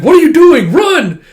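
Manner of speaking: high, loud urgent shout; frantic loud command; yelling. Retold or imitated. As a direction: yell at the dog to move. Yelling at the dog.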